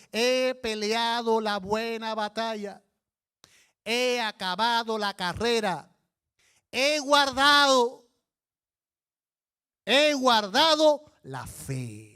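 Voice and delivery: slow, loud, proclaiming preacher voice; Paul's words preached. Acted. Preacher voicing Paul's farewell to the congregation, slow and loud. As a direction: declare it like a veteran apostle. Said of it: "Pablo está diciendo".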